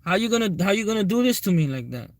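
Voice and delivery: betrayed fighter confronting his coach, indignant; retold angry disbelief. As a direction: throw it back at him, pitch up and wounded. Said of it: "I said".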